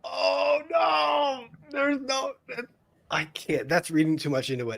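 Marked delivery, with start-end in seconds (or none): 0.0-2.3 high delighted shriek; delighted cry. Real, not acted. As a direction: cry out in gleeful shock. Reacting to judge's ruling.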